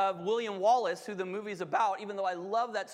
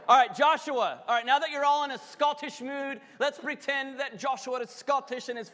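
loud, pumped-up call to start; rallying shout; 5 semitones higher, 6 dB louder than usual. Real, not acted. Preacher rallying the congregation into the reading, loud. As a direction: fire the room up.

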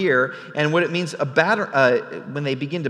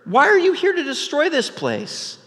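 indignant challenge; 8 semitones higher, 5 dB louder than usual. Retold or imitated. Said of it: "And she says".

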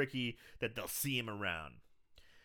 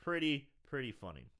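ordinary delivery

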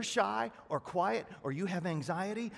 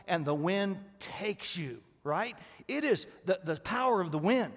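loud, driving sermon voice; a fired-up pastor pressing the point home; full-force preaching; 4 semitones higher, 7 dB louder than usual. Acted. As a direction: preach it out to the whole room.

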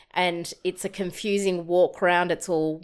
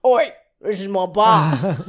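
loud, slow territorial squawk; bossy bird voice; 8 dB louder, slower than usual. Pretend. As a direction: shout like a greedy bully bird. Big Fatty bird chasing others off.

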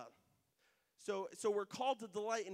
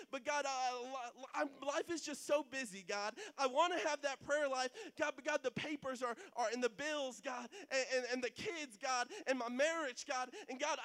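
a struggling believer pleading with God, higher; pleading prayer; 6 semitones higher, 4 dB louder than usual. Retold or imitated. Preacher voicing a tired believer praying to God, pleading. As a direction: cry out to God, overwhelmed and tired.

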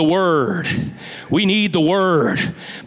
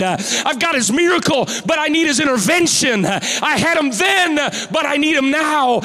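full-force preaching; 7 semitones higher than usual. Acted. Pastor urging the church to need God's hand, shouting. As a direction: build the sermon to its peak.

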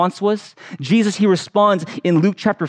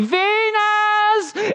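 put-on much higher child's wail of a name; panicked child yelling. Lost boy yells for his babysitter, much higher wail. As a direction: call out like a small boy lost in a store. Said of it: "I'm yelling".

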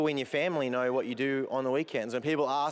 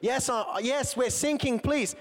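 higher, pleading sailor voice, hammed-up; mock panicked plea; 9 semitones higher than usual. First mate begging the coast guard for help, in a mock panicked voice. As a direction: beg for help as the ship goes down, half-joking. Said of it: "he's like".

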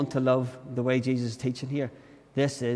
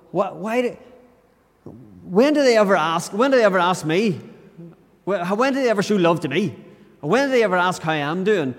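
higher, louder, exasperated complaint; frustrated gripe; 5 semitones higher, 4 dB louder than usual. Retold or imitated. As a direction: vent the grievance in your head. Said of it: "it'll say things like".

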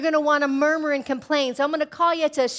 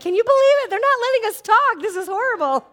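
high, loud hammed-up wail; mock crying; 11 semitones higher, 7 dB louder than usual. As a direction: wail to mom in comic despair. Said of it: "called my mom crying".

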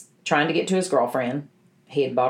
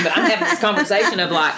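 talking through a laugh, a little higher and faster; 7 semitones higher, 4 dB louder, faster than usual. Real, not acted. To the host about praying, laughing as she speaks. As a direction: chuckle the words out at your own expense. Laughing at own prayer habit.

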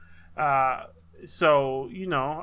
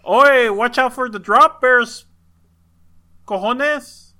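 louder, higher put-on Australian accent; mock Australian accent; 5 semitones higher, 6 dB louder than usual. Joking about their kid's mixed accent, Australian accent. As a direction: ham up an Aussie warning with Spanish slang. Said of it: "That's not a thing we say".

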